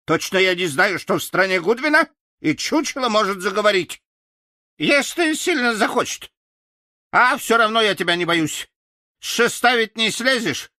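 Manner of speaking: high, quick, sassy crow voice; cheeky crow. Acted. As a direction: tease him from above like a bold bird. Crow teasing the scarecrow. (ворона дразнит Страшилу высоким голосом)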